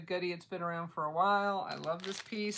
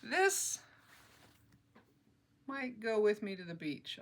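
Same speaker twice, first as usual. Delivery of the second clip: ordinary talk, nothing marked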